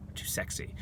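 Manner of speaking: a sly whispered brag; breathy whispered boast; whispered aside. Acted. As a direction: drop to a whisper for the punchline. Self-flattering aside, dropped to whisper.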